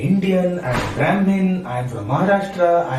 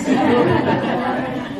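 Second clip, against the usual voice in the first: light laugh; 8 semitones higher than usual. Real, not acted. Laughs mid Guruji quote.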